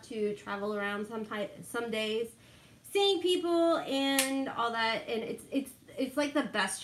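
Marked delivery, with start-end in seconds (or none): none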